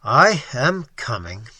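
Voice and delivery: "I am coming", each word slow and weighted; measured, deliberate stress; slow emphasis on each word. Acted. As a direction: lean on every word of "I am coming". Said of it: "slow and startling emphasis on each word".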